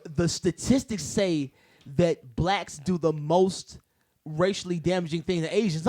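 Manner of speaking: normal talking voice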